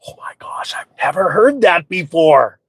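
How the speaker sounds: slow, whispered fake amazement; sarcastically awestruck hush; mock astonishment. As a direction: whisper it slowly, hamming up the shock. Mock astonishment at the angry lady.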